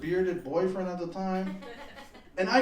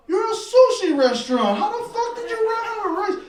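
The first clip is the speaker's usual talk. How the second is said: incredulous rant; 13 semitones higher, 9 dB louder than usual. Retold or imitated. Ranting at out-of-rice sushi place.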